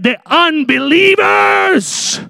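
high, slow shouted pronouncement; shouted preacher pronouncement; proclaimed. Real, not acted. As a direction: declare it like judgment day.

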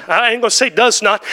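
ordinary delivery

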